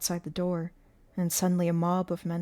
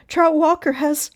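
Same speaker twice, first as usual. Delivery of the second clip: high, loud, cut short; alarmed and raised; urgent protest; 10 semitones higher, 11 dB louder than usual. A narrator's reading. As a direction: start an urgent accusation at full voice. Teacher urgently reporting, gets cut off.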